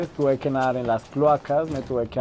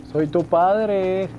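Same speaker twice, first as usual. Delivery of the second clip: alto y muy lento; voz dramática de padre; 6 semitones higher, much slower than usual. Pretend. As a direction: alarga "soy tu padre" con solemnidad. Repite la broma del padre. (voz solemne y lenta de padre)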